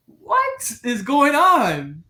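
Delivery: high, drawn-out disbelief; acted bewilderment. Golfer reacting to his partner's odd antics, bewildered. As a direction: stretch it out, loftily pitched and baffled.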